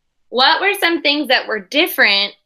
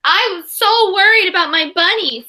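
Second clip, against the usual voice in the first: put-on high, louder child voice; little girl Sonia; 8 semitones higher, 4 dB louder than usual. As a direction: sound like a relieved little girl. Sonia tells Trixie her worry.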